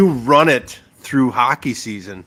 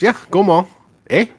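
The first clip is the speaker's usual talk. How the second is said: put-on higher U P accent with a tagged-on "Eh?"; 7 semitones higher than usual. Former U P student recalling his shortcut speech, U P accent. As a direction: playfully put on Upper Peninsula talk. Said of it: "that's my U P accent".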